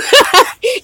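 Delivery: high bubbling laugh; joyful giggle. Real, not acted. Reader overjoyed for Townsend, high joyful giggle. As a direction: let a happy giggle escape.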